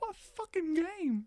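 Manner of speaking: high, drawn-out mocking echo; mock outraged confusion. Joining in teasing the left-out friend, high slow mimicry. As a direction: stretch it slowly, way up high.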